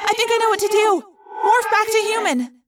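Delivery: high, loud, excited insistence; urgent. Acted. Friend who found a rescue plan, urgent and excited. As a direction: burst out with a sudden plan.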